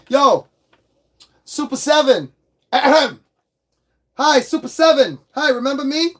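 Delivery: high, loud, pointed greeting; mock callout. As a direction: hail them with pointed mock politeness. Mock address to the toy company.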